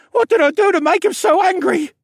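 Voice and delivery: put-on a scared kid shouting a question; boy asking his father mid-chase; frightened boy. A narrator's reading. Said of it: "Rylan asked".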